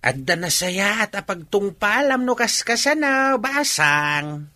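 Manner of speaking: put-on high, slow old man's wheedle; wheedling old man. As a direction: draw it out high, coaxing the young woman. Old man propositions young woman.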